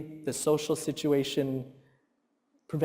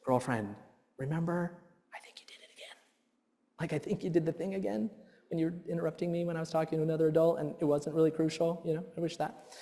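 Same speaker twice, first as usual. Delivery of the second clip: put-on softer, quiet gentle reminder to the girl; 10 dB quieter, faster than usual. Dad gently reminding his daughter, soft. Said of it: "turn to her and say".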